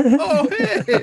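high, loud startled cry; mock startled yelp. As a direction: jump like hit on the head. Acting hit by slingshot note.

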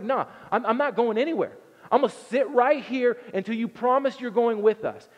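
high, loud defiant refusal; mock sit-in protest; stubborn Moses. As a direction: Moses digging in his heels. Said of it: "He's like".

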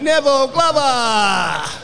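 put-on drawn-out, high call of a name, like a kid in the backyard; kid yelling name. Retelling how a young fan yells the name mid-play, in a high drawn-out call. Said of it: "and yells out".

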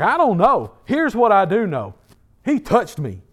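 high, loud retort easing down; defiant testimony. Acted. Healed man answering his accusers, defiant testimony.

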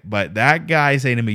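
normal talking voice

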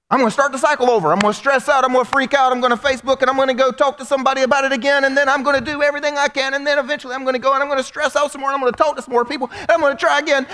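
high, fast, breathless-sounding list; frantic rant. Acted. Preacher acts out the stress cycle, frantic rush. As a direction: race through it at one high pitch.

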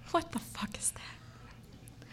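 slow whispered disbelief; stunned whisper. Retold or imitated. An RA seeing the filthy sheets, a slow stunned whisper. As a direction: breathe it out, horrified.

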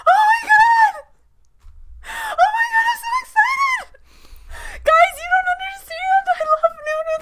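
very high squealing fangirl shout; ecstatic shriek. Acted. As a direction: squeal with pure delight. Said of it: "Diana's so excited right now".

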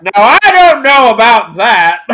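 a Foghorn Leghorn-style hammy Southern lawyer. Acted. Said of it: "a Southern lawyer".

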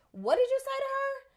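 confronting demand. Retold or imitated. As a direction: challenge the man hard, pitch up on "did". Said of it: "he was like".

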